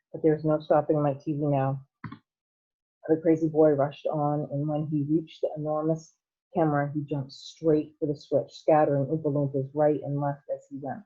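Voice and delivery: ordinary delivery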